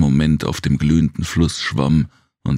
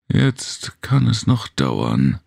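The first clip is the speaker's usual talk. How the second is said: slow, higher, resigned sigh of a line; 7 semitones higher, slower than usual. A narrator's reading. Jem giving up on the stuck cart, slow and resigned. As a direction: let it sag with resignation. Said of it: "meinte Jem resigniert".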